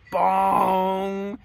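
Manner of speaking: long, held ringing "bong"; drawn-out cartoon impact noise on one note; imitated bonk. Ball hit him in the head, imitated bonk.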